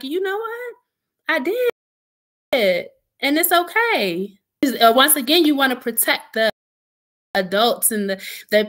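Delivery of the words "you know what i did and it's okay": self-acceptance. Retold or imitated. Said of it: "had to think about it and be like".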